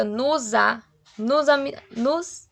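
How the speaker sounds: "nos a" run together as "no-za"; linked syllables. Shown as a demonstration. Teacher showing the joined sound, linked "nos a". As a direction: slide the s of "nos" into the a. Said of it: "ele já juntou com o a".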